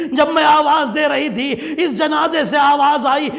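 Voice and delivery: high, keening elegy climbing into a wail; high-pitched grieving sermon chant; lamenting majlis recital. Acted. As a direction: mourn it out like a zakir near tears. Mourning recital, rising wail.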